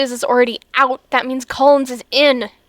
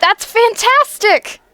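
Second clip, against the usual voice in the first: excited; 8 semitones higher, 3 dB louder, slower than usual. Acted. Will hears the good news.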